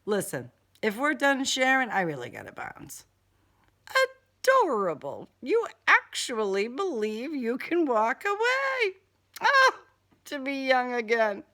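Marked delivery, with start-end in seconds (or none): 4.4-11.4 high, amused sigh of a villain; mocking villain. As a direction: savour it like an old predator. Killer character voice, audiobook.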